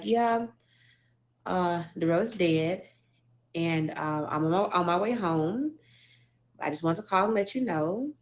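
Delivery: mildly imitating her own calm delivery of bad news; understated stoic retelling. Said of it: "they said I was just so, like, stoic".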